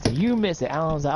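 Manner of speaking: ordinary talk, nothing marked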